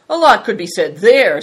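pointed contempt; scornful. A narrator's reading. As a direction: throw it back with scorn. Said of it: "said Green Fortune scornfully".

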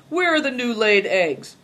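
loud, high, insolent demand. A narrator's reading. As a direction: demand it as if owed. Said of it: "said Greencoat insolently".

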